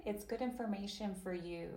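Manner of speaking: usual speaking voice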